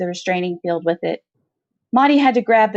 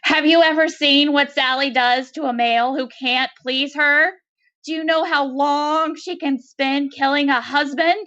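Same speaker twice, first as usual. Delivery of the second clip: higher, wailing plea through a vocoder; Kurtz's wail; 6 semitones higher than usual. A narrator's reading. Kurtz pleads with Maudie in a higher wailing voice. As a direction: wail it like a frightened creature. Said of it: "giving Kurtz a human-sounding wail".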